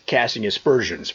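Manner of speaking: ordinary talk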